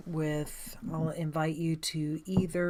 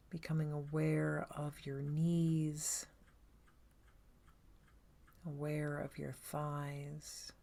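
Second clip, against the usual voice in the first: guided meditation; 3 dB quieter than usual. Acted. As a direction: guide them gently inward, leaving space after each phrase.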